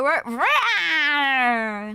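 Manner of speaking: little lion cub's loud roar; baby Simba roar. Pretend. Streamer playing at baby lion, drawn-out loud roar. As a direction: roar like a small cub. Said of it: "it's like a baby Simba".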